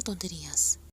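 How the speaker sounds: whispered low, brushing it off; offhand. Acted. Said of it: "dijo a la ligera".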